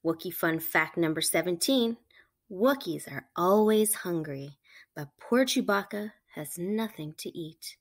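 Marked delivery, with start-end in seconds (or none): none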